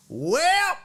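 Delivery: imitated high, drawn-out huffy goodbye; announced exit. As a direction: draw out a high parting line as the offended lady. Old lady announces she is leaving.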